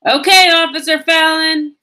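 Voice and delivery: high, loud holler. Acted. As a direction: call out to the officer. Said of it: "Then she hollered".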